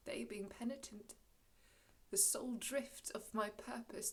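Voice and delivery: hushed, measured resolve; quiet forgiveness. Acted. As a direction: soften as you give up revenge. Prospero softens, choosing mercy over vengeance.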